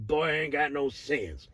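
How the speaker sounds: drawn-out, laughing cartoon rooster; Foghorn Leghorn. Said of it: "Foghorn Leghorn".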